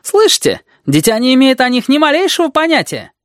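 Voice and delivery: very high-pitched exclaiming; indignant outcry. A narrator's reading. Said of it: "вскричал он".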